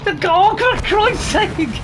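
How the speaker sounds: high, loud cry of frustration; exasperated yell. Real, not acted. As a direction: burst out at a failed grab. Player cannot grab gun.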